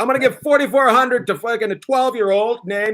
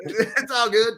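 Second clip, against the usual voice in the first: laughing; 4 semitones higher, 4 dB quieter, slower than usual. Real, not acted. Laughing, repeating the line.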